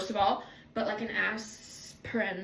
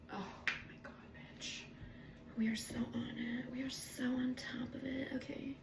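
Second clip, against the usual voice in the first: whispered glee; 11 dB quieter than usual. Real, not acted. Vlogger whispers to viewers they are on it. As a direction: hush it conspiratorially.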